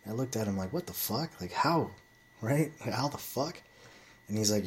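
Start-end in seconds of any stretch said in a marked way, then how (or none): 0.7-3.6 retold disbelief. Recalling his buddy's advice at the gym, incredulous and pitched high. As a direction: replay your stunned reaction to the gym buddy.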